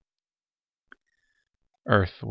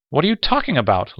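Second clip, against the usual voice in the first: much higher, louder agitated outburst; excited stutter; 8 semitones higher, 7 dB louder than usual. A narrator's reading. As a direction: blurt it out, shocked and worked up. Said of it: "Lamar stuttered excitedly".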